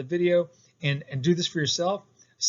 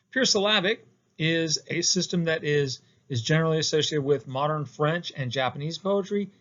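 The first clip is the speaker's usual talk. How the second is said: normal talking voice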